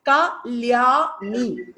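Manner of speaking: KALYANI very slowly as ka, lya, ni; syllable by syllable. Shown as a demonstration. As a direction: sound out KALYANI in three drawn-out beats. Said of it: "That is how you write".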